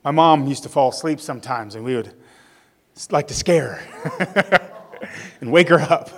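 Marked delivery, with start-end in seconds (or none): 2.9-6.2 real chuckle breaking into the words; amused, giggling between phrases; laughing. Real, not acted. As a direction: tell it through your own laughter. Amused memory of scaring mom.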